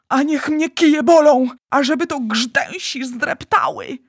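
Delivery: high, loud outburst; exasperated cursing. Acted. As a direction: curse the bad luck loudly. Giant curses his catch.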